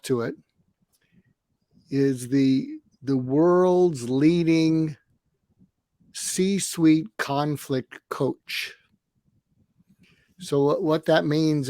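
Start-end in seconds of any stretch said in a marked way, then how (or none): 3.0-8.7 each word drawn out, savoured; slow proud emphasis. Real, not acted. Proudly reading out his own LinkedIn title, slowly. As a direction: announce the title slowly, with pride.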